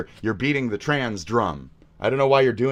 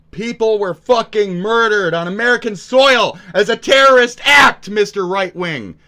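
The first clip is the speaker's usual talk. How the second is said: much higher and louder, furious shouting; furious yelling; 9 semitones higher, 7 dB louder, slower than usual. Real, not acted. Ranting at streamer Asmon over his take, furious yelling. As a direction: tear into him at full volume.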